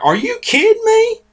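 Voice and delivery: high-pitched disbelieving squeak; mock the listeners' disbelief, way up high; incredulous. Friends' reaction to the news, squeaky disbelief. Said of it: "they're like".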